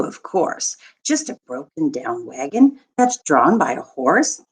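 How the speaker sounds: normal talking voice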